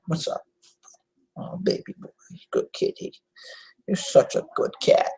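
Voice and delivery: soft breathy whisper to the cat; whispered pet talk. Real, not acted. Owner whispers praise to his cat, whispered baby talk. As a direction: whisper sweet nothings to a pet.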